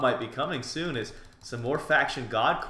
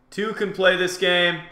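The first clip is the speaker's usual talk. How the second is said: higher, louder challenge; raised taunt; 5 semitones higher, 4 dB louder than usual. Real, not acted. Player firing back at an opponent, loud taunt. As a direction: throw the challenge back, voice lifted.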